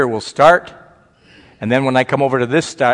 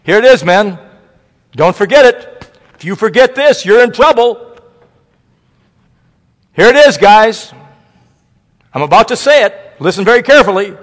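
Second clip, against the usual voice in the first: much higher and louder mock preacher build-up; hammed-up preacher; 10 semitones higher, 10 dB louder than usual. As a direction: ham up the speaker stalling, shouting it out. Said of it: "This is all he said".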